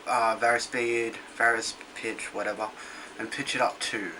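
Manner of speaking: normal talking voice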